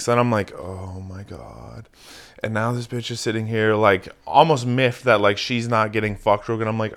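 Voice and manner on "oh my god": slow, hushed exasperation; quiet dismay. Retold or imitated. Reluctant man reacting to date's advances, slow quiet dismay. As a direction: draw it out softly, as if to yourself.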